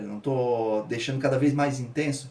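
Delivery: ordinary delivery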